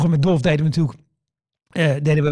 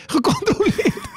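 slow, high call breaking into laughter; laughing call; 14 semitones higher, much slower than usual. Retold or imitated. Audience member calls condolences again, slow and laughing. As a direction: draw it out, laughing as you shout. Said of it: "begonnen mensen te schreeuwen uit de zaal".

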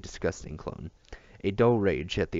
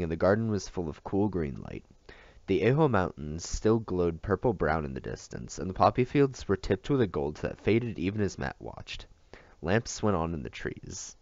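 ordinary delivery

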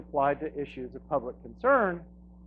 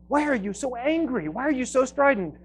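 much higher, needling reporter's question; mock the pushy interviewer, pitched up high; 9 semitones higher than usual. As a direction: reporter badgering a calm guest, mocked in a high voice. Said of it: "the reporter keeps asking him".

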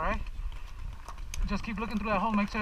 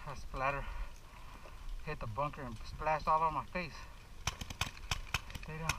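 low, hushed under fire; 6 semitones lower, 12 dB quieter than usual. Real, not acted. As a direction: keep your voice down behind cover. Hiding behind bunker.

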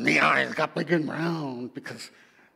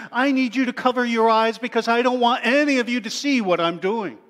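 louder, higher and quicker command to all; commanding Creator; 8 semitones higher, 12 dB louder, faster than usual. Acted. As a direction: call out to every creature to cover their eyes.

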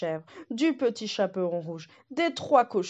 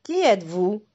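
lightly voiced polite wolf; a hint of a gruff wolf; wolf's voice; 5 dB louder than usual. The wolf Lucas questions the goat, lightly voiced.

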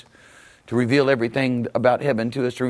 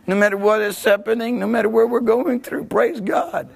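climbing higher and higher into praise God; rising preacher shout; 10 semitones higher than usual. Real, not acted. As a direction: build it up to a jubilant cry.

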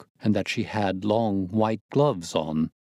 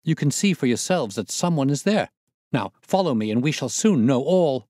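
raised, pressing and eager; excited urging, voice raised; urgent; 6 semitones higher, 3 dB louder than usual. A narrator's reading. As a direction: push them forward, keyed up. Munro urging them on.

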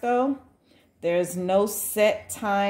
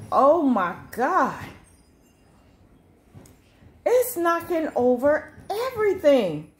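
alarmed surprise; 8 semitones higher, 3 dB louder than usual. Real, not acted. Wind knocks things over outside.

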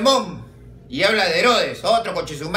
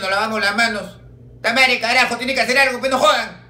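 starts indignant and climbs to a loud high furious rant; angry complaint; 6 semitones higher, 3 dB louder than usual. Acted. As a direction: build from grievance to yelling. Argentines complain furiously to bishop.

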